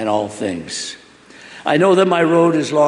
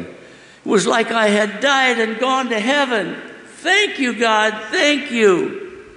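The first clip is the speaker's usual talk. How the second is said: much higher, rapturous thanks; ecstatic gratitude; 9 semitones higher, slower than usual. Acted. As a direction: thank God as if in heaven. Boy grateful, feels in heaven.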